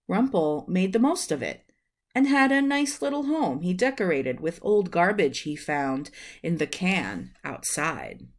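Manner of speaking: plain reading voice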